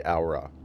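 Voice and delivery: "aura" said as "ow-rah". Shown as a demonstration. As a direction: say "aura" with an "ow" first syllable. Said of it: "that's the more correct way".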